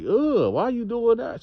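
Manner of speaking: high grossed-out whine; recoil, voice shooting up high; disgusted squeal. Acted. Grossed-out reaction to piss jars.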